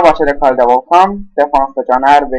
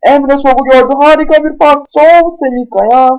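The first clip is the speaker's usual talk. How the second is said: excited commentator; 9 semitones higher, 4 dB louder than usual. Acted. Attack call in football game.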